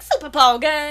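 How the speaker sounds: loud, hyped-up name reveal; pizzazz. Retold or imitated. Host hyping her co-host's channel name, loud and showy. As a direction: sell the name like a flashy announcer. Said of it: "Also, it has pizzazz".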